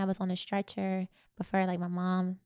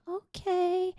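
put-on much higher, small child's okay; injured five-year-old answering her mother, tiny high voice; little girl's okay; 12 semitones higher than usual. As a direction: answer like a timid little kid. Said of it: "I said".

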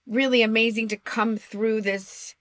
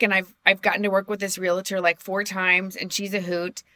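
ordinary talk, nothing marked

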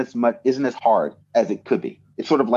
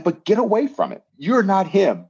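higher, insistent push to let it go; mock pep talk to a hurt friend, pitched up; urging a friend; 7 semitones higher than usual. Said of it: "I'm like".

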